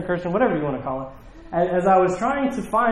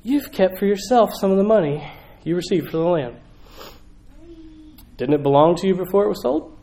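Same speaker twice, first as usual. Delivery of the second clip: crushed; 5 semitones higher than usual. Retold or imitated. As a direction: ask it as if betrayed by a friend. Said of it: "I think Peter's crushed".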